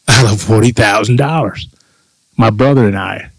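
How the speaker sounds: usual speaking voice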